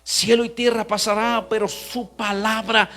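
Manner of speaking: ringing pulpit declaration, stretched at the climax; full-force preaching. Acted. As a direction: proclaim the promise with growing force. Preaches God's promise at full force.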